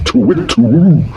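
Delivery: high hooting owl. Acted. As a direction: hoot it like a startled owl. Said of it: "owl hooting".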